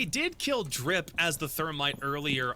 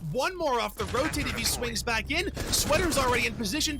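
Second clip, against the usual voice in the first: live play-by-play; 8 semitones higher than usual. Real, not acted.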